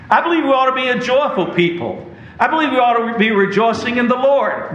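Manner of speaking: rising excitement. Real, not acted. Preacher exhorting joy.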